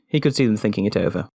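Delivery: ordinary delivery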